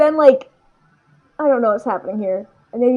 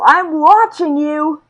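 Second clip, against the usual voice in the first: higher, drawn-out yell; Todoroki; 5 semitones higher, 4 dB louder, much slower than usual. Retold or imitated. Todoroki yelling to his father as he fights, retold yell. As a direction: call it out slowly, pitched up. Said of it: "And Todoroki yells".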